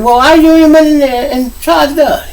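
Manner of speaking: high, loud, nagging question; exasperated scolding. Retold or imitated. Scolding a friend over his dead phone, exasperated. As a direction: push the pitch right up in exasperation.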